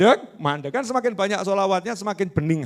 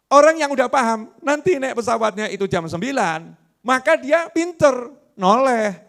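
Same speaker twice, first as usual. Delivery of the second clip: excited preaching; 7 semitones higher, 5 dB louder than usual. Real, not acted. Preacher excited about wise passenger.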